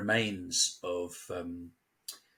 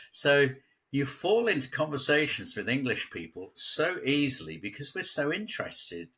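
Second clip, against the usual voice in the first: usual speaking voice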